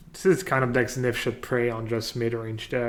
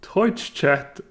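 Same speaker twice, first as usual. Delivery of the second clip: slow Irish line, fighting giggles; Irish accent breaking into laughter; 3 semitones higher, 5 dB louder, slower than usual. Shown as a demonstration. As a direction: try the Irish line, cracking up. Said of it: "Practice sentence".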